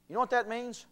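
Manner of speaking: ordinary talk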